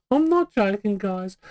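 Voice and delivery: normal talking voice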